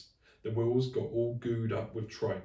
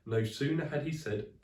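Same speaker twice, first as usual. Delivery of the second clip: plain reading voice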